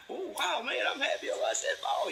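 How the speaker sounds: put-on very high, delighted child voice; excited little child. Praised child delights at saying ball, very high voice. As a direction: squeal with pride at the praise. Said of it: "the little child is just getting".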